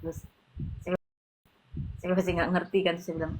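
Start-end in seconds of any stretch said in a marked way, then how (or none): none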